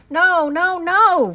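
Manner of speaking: high, loud cries of no; a child shouting no, no, no; little boy yelling no; child's shout. A narrator's reading. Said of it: "Fudge shouted".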